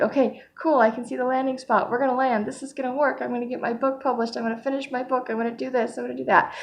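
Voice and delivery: high, hopeful pep talk to herself; eager self-talk. Retold or imitated. Acting out her own hopeful self-talk.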